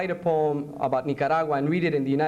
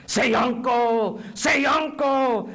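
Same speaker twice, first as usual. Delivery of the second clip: loud, high, drawn-out command; torturer demanding surrender; 8 semitones higher, 4 dB louder, much slower than usual. Acted. Interrogator forcing a prisoner to give in, loud slow shout. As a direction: shout it slowly, twice, like an order.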